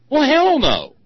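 very high, loud holler of refusal; emphatic yell. Acted. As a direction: holler it as an outraged, mocking refusal. Emphatic answer to own sarcastic question.

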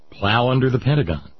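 ordinary talk

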